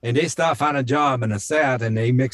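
Cajun lilt with dropped endings; Cajun accent. Shown as a demonstration. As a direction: slip into a demonstrated Cajun accent. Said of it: "they start getting the Cajun accent".

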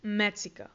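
"Mexico" said the English way, with an x as "ks"; English pronunciation. Shown as a demonstration. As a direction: model "Mexico" as MEK-si-co, falling. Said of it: "México se dice". ("Mexico" pronunciado en inglés, con x de "ks")

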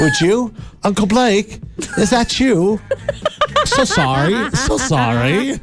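light British impression; mock British accent. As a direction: fuss politely in a put-on English accent. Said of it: "British people are too polite".

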